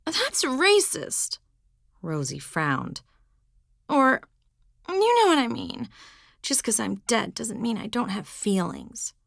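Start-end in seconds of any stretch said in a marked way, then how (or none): 0.0-1.4 slow, high, mock offense; drawn-out indignant teen girl; offended teen. Rosie offended. 3.9-9.1 higher, louder sulky protest; pouting teen complaint; defensive teen girl. Acted. Rosie backpedalling.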